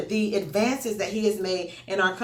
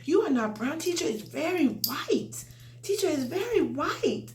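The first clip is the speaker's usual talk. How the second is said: imitating child; 8 semitones higher, 4 dB quieter than usual. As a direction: mimic a small boy insisting earnestly. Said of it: "he said".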